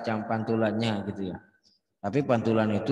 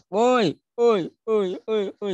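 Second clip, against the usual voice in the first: very high loud woi, repeats dying away; echoing calls; 10 semitones higher, 4 dB louder, slower than usual. Shown as a demonstration. Teacher imitating an echo for students, high fading calls. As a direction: call out like across a canyon. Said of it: "ya, saya kan gitu".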